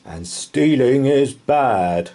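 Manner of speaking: slow, loud, scolding knight voice; a knight lecturing about stealing; stern knight. A narrator's reading. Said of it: "said the knight".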